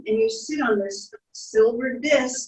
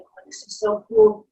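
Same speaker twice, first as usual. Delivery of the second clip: delighted; 11 semitones higher, 6 dB louder than usual. Pretend.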